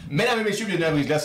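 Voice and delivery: announcer welcome. Acted. Host opening the show to listeners, grand announcer voice. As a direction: welcome the audience like a showman.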